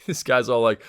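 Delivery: normal talking voice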